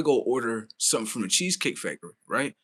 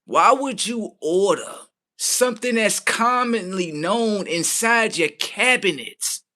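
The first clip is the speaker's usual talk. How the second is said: usual speaking voice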